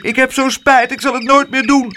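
high, fast wailing plea. A narrator's reading. The boy wailing his apology, high and fast. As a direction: whine out the apology. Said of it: "huilde en klaagde en riep".

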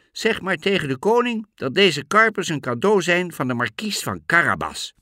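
high, loud cat announcing a gift; talking cat. A narrator's reading. (hoge, luide stem van de kat)

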